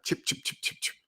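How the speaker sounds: very soft, voiceless chattering noises; imitated chitter-chatter. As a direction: mimic idle chatter in tiny whispered chirps. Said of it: "They chitter chatter".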